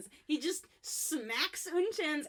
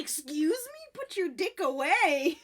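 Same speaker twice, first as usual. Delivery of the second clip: slow, louder outraged put-down; indignant; 5 semitones higher, 6 dB louder, slower than usual. Retold or imitated. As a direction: scold him in mock horror. Girl shocked at boy peeing.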